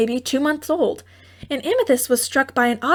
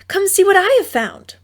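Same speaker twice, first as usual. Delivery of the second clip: higher and louder, eager; excited call; 9 semitones higher, 5 dB louder than usual. A narrator's reading. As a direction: share the discovery. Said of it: "she called".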